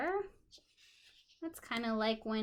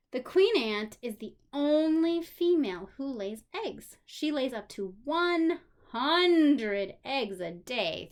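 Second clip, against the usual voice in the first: put-on reading to children; 6 dB louder than usual. A narrator's reading. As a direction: clear storybook reading. Reading a children's book aloud.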